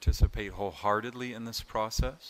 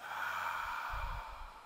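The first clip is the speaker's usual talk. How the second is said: long, airy exhale; demonstrated releasing sigh; big open-mouthed sigh; 7 dB quieter than usual. Shown as a demonstration. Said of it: "exhale with a big sigh and an open mouth".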